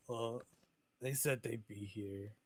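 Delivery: a little crestfallen. Acted. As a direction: let the disappointment show quietly. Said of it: "Dermot's a little crestfallen".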